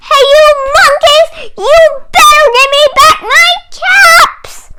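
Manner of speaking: high, loud, fast angry yell; furious shout. Acted. Peddler stamping his foot, yelling high, loud and fast. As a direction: stamp and scream at the monkeys. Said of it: "stamped his foot and said".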